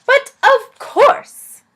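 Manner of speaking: high, loud, slow theatrical elf cry; eager elf. Acted. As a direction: gush it like a delighted courtier.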